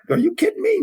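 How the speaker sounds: high, disbelieving cry of "are you kidding me"; like he can't believe the question; incredulous. Retold or imitated. Coach reacting to quitting talk, incredulous and high. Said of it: "It's like".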